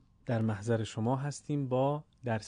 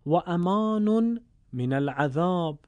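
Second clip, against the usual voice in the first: higher, slow Arabic recitation of the saying; slow raised recitation; 4 semitones higher, 4 dB louder, slower than usual. Acted. Teacher ending the hadith to listeners in raised recitation. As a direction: lift and stretch the closing words reverently.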